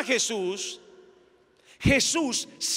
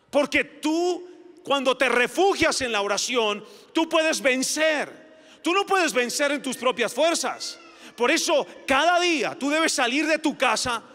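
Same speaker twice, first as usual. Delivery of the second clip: raised, impassioned sermon voice; fervent preaching; 4 semitones higher than usual. Acted. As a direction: preach it with rising urgency. Preacher building to full force.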